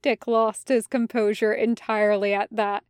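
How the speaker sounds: plain reading voice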